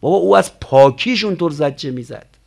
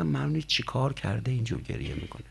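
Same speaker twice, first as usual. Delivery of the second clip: gossiping relatives; 3 semitones lower, 10 dB quieter than usual. Retold or imitated.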